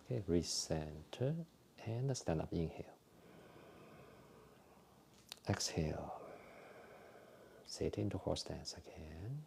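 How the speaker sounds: soft, calm breathing cues with whispered exhales; guided exercise instructions. Acted. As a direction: talk the class gently through each movement. Leads a qigong breathing routine.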